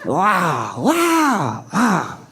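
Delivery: high, drawn-out wow; high, mock stunned wows; mock amazement. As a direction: gasp it in mock awe.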